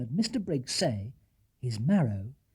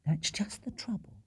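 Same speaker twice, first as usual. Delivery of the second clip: hushed whisper; 6 semitones higher, 7 dB quieter than usual. Acted. Wife whispering to husband in the dark. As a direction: whisper so no one hears. Mrs Brown whispers at midnight.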